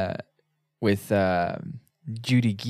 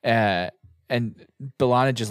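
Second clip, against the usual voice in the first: usual speaking voice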